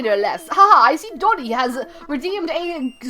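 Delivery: put-on British accent, swooping up and down. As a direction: play a hooting, well-mannered owl with a British accent. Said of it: "give him some kind of British accent".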